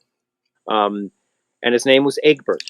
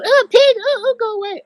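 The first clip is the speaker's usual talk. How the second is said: shrill high squeal of alarm, much louder; mock-shriek like a startled kid; shrieking visitors; 4 dB louder than usual. Visitors shrieking at the pig, loud high squeal. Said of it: "they go".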